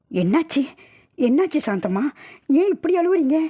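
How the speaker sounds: high, anxious questions rising in pitch; alarmed questioning. Acted. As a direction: ask in mounting alarm. Alarmed at the weeping woman. (பதட்டமான கேள்வி)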